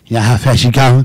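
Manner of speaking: low, loud trooper's challenge; put-on low cop voice; mock state trooper. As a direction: imitate a cop at the car window. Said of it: "he rolls down the window".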